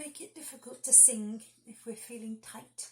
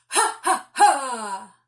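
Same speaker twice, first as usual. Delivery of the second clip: Father Christmas laugh; 2 semitones higher, 16 dB louder, much slower than usual. Shown as a demonstration. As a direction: bounce a big jolly Santa laugh from the tummy. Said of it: "nice big sort of Father Christmas laughing".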